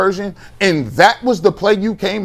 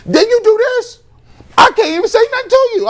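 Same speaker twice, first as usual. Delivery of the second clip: exasperated outburst; 4 dB louder than usual. Real, not acted. Host venting at the head coach, exasperated high outburst. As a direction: voice shoots way up, fast and exasperated.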